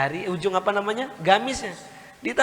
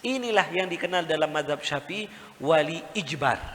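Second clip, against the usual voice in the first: ordinary talk, nothing marked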